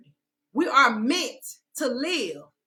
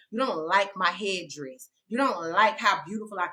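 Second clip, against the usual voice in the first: ordinary talk, nothing marked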